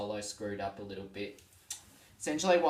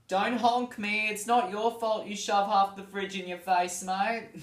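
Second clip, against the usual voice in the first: higher and louder mock taunt, slowed at the start; mock bumper sticker; 9 semitones higher, 7 dB louder than usual. Vlogger acting out a car sticker as a loud mock taunt. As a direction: shout it as a cheeky car sticker.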